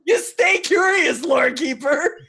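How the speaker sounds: high, slow teasing send-off; mocking jab. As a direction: call it out mockingly, laughter underneath. Teasing acted jab at another player.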